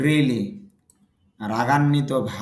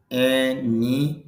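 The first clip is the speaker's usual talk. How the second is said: এ, নি spelled out very slowly; slow syllable by syllable; much slower than usual. Shown as a demonstration. Teacher slowing down to split the syllables. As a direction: break the word into slow syllables. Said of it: "এখানে খেয়াল করেন".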